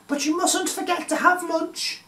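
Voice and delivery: high, sing-song mum voice; a bright-voiced mother reminding her kids; mummy's voice. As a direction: mum reminding the children about lunch, high and chirpy. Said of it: "said their mummy".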